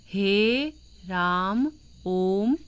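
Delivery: हे राम ओम drawn out; prolonged vowels. Shown as a demonstration. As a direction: stretch हे, राम, ओम. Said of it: "लुप्त हो जाता है बोलने में".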